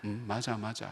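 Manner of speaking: soft, half-voiced "맞아, 맞아"; murmured agreement. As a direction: hum along calmly while listening. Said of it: "이 사람은 있냐 하면서". (조용히 맞장구치며 낮게 중얼거리듯)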